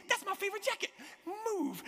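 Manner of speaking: high-pitched acted indignation; indignant outburst. Man to a stranger blocking his way, indignant outburst. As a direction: protest way up high, hammed-up.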